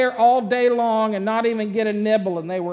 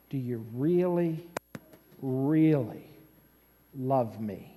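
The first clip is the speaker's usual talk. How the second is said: low, soft, spaced-out question; earnest probing; 9 semitones lower, 7 dB quieter than usual. Acted. Jesus asking Peter a third time, earnest probing. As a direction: ask it quietly, pausing before each key word.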